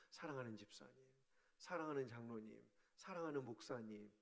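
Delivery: soft, low loving names; tender address. Shown as a demonstration. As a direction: gently savour each title. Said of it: "행복해지네요". (부드럽고 낮게 다정히 부르는 호칭들)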